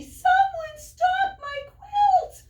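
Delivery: very high, loud cry of alarm; little quail shrieking in panic. Acted. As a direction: yell for the stolen quilt. Quincy calls for help.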